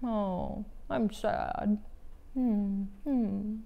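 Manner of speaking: soft, droopy mock sadness for kids; gently hammed-up sad hums; sad face voice. Shown as a demonstration. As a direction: sigh it out like a sad little kid. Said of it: "Mine would be like".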